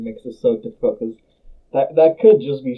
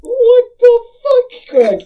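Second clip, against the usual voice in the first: shocked outburst; 7 dB louder than usual. Real, not acted. Shocked by the soap option.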